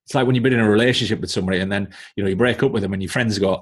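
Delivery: normal talking voice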